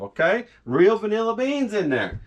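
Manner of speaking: ordinary delivery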